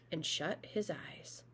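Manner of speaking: slow, sleepy whisper. Acted. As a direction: let it fade into a slow whisper. Tired rabbit falls asleep.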